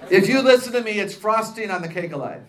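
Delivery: loud laughing through the words. Real, not acted. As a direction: laugh it out, amused at herself. Jokes about loving own voice.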